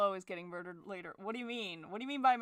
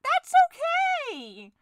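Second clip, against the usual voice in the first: very high, loud, slow sung okay; manic cheer; 11 dB louder, much slower than usual. Acted. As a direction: shout the fake cheer high and drawn out. Manic cheer bit repeated.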